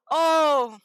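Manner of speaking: ordinary talk, nothing marked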